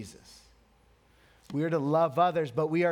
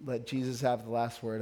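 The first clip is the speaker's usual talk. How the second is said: ordinary talk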